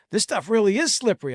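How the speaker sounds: a touch of put-on Texas twang; Texas accent. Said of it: "in a Texas accent".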